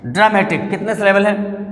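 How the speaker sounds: normal talking voice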